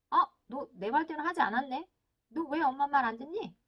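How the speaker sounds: acted parent demanding an explanation; mock snapping. Parent confronts child who ignored advice, mock snapping. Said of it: "아이한테 쏘아붙이겠죠".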